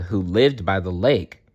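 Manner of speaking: plain reading voice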